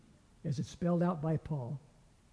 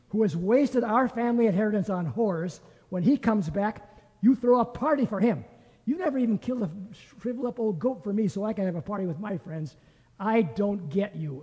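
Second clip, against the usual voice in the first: louder and higher, resentful and building; indignant complaint; 4 semitones higher, 4 dB louder than usual. Acted. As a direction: throw the grievance at your father. Older brother's bitter complaint to father.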